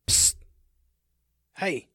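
sharp psst, then a short hey; furtive call. Acted. The man has his target in sight, calling furtively to Led. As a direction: get someone's attention with hissed pssts. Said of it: "he called to Led".

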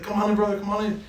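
high, friendly imitation of a welcoming barber; sweet welcome. Sweet barber welcoming a new customer, high and friendly. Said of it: "Sweet guy".

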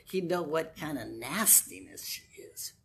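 sneering whispered aside; scornful whisper. Acted. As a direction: hiss it behind Jesus's back.

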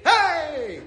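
high, drawn-out hello, acted out; calling out greeting. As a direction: call it out to a whole room. Calling out to startled classroom.

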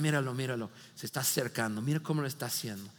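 low, soft murmured gossip behind a hand; hushed gossip. Retold or imitated. Disciples gossiping about Jesus, hushed. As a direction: mutter it like disciples telling on someone.

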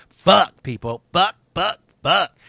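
loud, bursting curses; frustrated outburst. Real, not acted. Vlogger fed up with entitled people, angry cursing burst. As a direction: spit out the curses in real exasperation.

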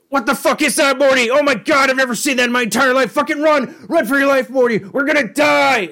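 very high, loud panicked screaming; a sudden terrified flip from calm to fleeing; Rick panicking. Retold or imitated. Rick yells at Morty to run for his life, high and screaming. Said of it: "immediately goes".

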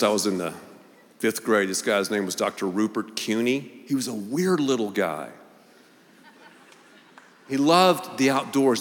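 ordinary delivery

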